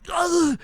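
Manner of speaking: high strained groan; mock stifled cry of agony; pained groan. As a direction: squeeze out a high, pained noise. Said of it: "I was just like".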